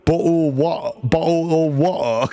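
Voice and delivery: put-on British accent for the bottle of water; mock British accent. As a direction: play up a posh British water. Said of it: "W A space E R".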